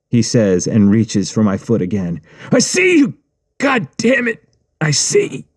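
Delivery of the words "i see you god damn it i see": high shrieking in pain; agonized scream. Acted. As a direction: scream the answer through the pain. Writer screaming as foot grabbed.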